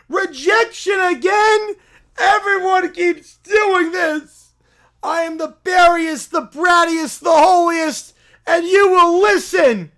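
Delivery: pushy self-proclaimed prophet bellowing; loud holy crusader voice; zealous preacher. Acted. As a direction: barge in demanding converts. Preacher rejected again.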